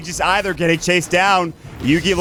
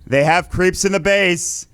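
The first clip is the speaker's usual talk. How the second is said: excited play-by-play; 6 semitones higher than usual. Acted. Climax of the game cast.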